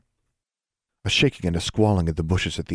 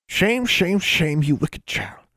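put-on high old granny calling out a child's full name; a scolding great-grandmother, pitched up high; stern old granny; 11 semitones higher, slower than usual. A narrator's reading. Great-grandmother catching the snooping girl, high stern granny voice.

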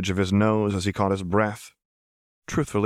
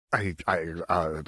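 halting, timid stammer; lost for words, trailing down; 2 semitones lower, 4 dB quieter than usual. A narrator's reading. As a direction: falter on each word. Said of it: "Rana stammered".

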